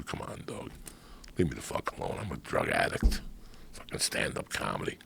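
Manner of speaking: low whispered brush-off; hushed annoyed dismissal; dismissive brush-off. Retold or imitated. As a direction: wave him off under your breath. Retold brushing off friend.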